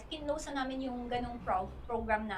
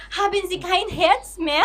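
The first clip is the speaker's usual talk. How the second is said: slower, much louder, higher outraged German demand; indignant plea in German; 5 semitones higher, 12 dB louder, slower than usual. Retold or imitated. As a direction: challenge them on having no heart. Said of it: "Sabi niya".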